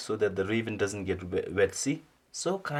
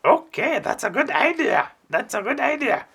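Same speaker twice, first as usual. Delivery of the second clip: much higher, louder eager bird voice; delighted raven; 9 dB louder than usual. Acted. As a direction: squeal with delight at the idea. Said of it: "the raven said".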